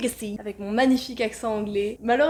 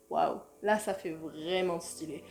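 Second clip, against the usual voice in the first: lower, softer and slow wow; slow hushed wow; awed; 7 semitones lower, 7 dB quieter, slower than usual. Real, not acted. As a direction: marvel at the look quietly. Impressed by her character.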